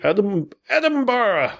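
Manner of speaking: high-pitched attempt at Scottish Edinburgh; Scottish accent. Shown as a demonstration. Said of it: "do your Edinburgh thing".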